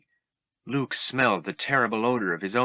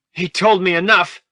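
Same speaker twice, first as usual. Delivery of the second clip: higher, much louder furious yell; angry yell; 10 semitones higher, 8 dB louder than usual. Acted. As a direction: hurl it at him in anger. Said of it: "he yelled".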